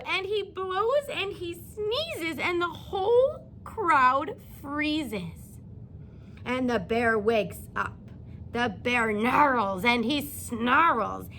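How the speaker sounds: put-on slow, lilting read-aloud to young children; storybook reading. A narrator's reading. Teacher reading a bedtime story to her class, gently lilting. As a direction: read it like story time for little kids.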